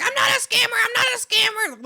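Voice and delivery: high, loud shouted protest; angry denial. Retold or imitated. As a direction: yell it like a caught scammer freaking out. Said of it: "so pissed at me".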